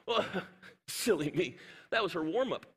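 normal talking voice

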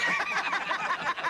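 sudden high-pitched burst of laughter. Real, not acted. Host laughs at film scene.

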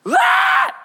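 very high, loud infant scream; sudden piercing mock baby cry; imitated baby wail. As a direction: shriek like a baby waking up. Imitates the baby crying once more.

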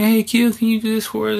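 high mock-polite coworker voice; imitating coworkers. Coworkers asking the teacher for help, high mock request. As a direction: ask the favour in a high, sing-song voice. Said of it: "and they ask me".

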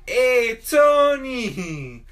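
very slow, high, loud drawn-out greeting; calling out. Retold or imitated. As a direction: stretch the greeting like a big welcome. Greeting across classroom.

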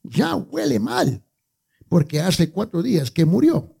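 high-pitched, slow whiny complaint; Martha complaining. Acted. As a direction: play Martha, fretting and protesting to Jesus. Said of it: "le dijo".

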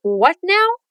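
mock-annoyed "what NOW?"; playful exasperation. Shown as a demonstration. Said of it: "Watch out for the intonation".

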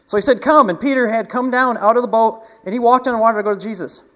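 loud, high, driving preacher voice; full-force preaching. Acted. Pastor retelling Peter on the water, loud and high. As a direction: lift the story to the back row.

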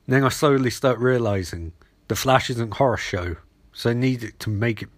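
ordinary talk, nothing marked

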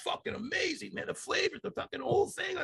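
high, excited praise of the smoke; thrilled reaction. Retold or imitated. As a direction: gush about it, high and thrilled. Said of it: "and I said".

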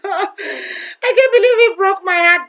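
high, slow laugh-crying words; laughing through heartbreak. Real, not acted. Recalling the ex who broke her heart, laugh-crying. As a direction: laugh and half-sob at once.

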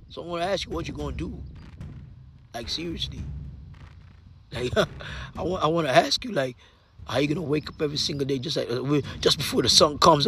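low, soft, intimate voice over the music; hushed motivational talk. Acted. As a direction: lean in close and speak softly to one listener. Quiet intimate stretch over music.

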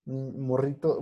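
ordinary talk, nothing marked

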